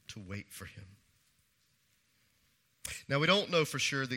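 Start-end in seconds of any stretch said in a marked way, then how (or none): 0.1-0.8 soft repeat. Acted. Third point repeated hushed.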